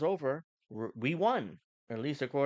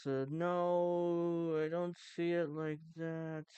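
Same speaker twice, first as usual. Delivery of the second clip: slow politician drawl; much slower than usual. Shown as a demonstration. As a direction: imitate a sleepy ex-politician. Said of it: "talking like this".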